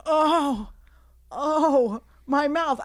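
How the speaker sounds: high, loud cry of pain; mock hurt victim. Old guy hit in the face by a bottle, mock hurt. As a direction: exaggerate being wounded by almost nothing.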